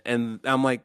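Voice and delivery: normal talking voice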